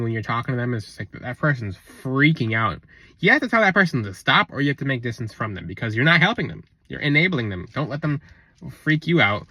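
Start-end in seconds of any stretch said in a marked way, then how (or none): none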